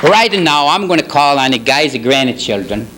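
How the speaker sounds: normal talking voice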